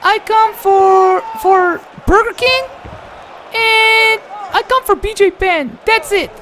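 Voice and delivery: very high squeaky voice; Anderson Silva impression. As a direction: pitch way up like a chipmunk. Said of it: "this is my impersonation of Anderson Silva".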